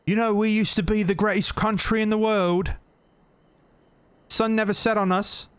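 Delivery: high, put-on posh British voice; mock a proud old Brit; mock British patriot. Mocking British patriot quote.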